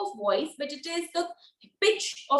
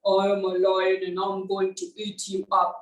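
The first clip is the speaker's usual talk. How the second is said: low, steady lion; deep lion voice, evenly paced; 7 semitones lower than usual. Shown as a demonstration. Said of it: "Now it could be like this".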